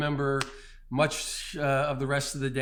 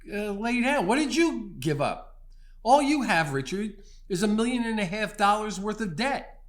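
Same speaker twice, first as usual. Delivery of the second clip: self-rebuke; 6 semitones higher than usual. Retold or imitated. Preacher to congregation, retelling scolding himself: rising incredulous rebuke. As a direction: voice climbing higher, scolding himself in disbelief. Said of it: "I stopped in my tracks and I went".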